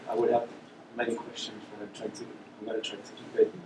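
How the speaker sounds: soft, hard-to-hear question from the audience; too quiet. Real, not acted. Audience member starts a question to the speaker, too quietly. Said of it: "Can you speak up?".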